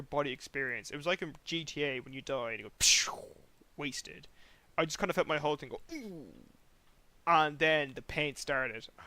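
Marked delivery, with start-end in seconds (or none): 2.8-3.1 imitated rushing noise; voiceless whooshing video-game effect; game death sound. 5.9-6.3 soft, high ooh sliding down; imitated falling ooh. Imitates his whole body reacting.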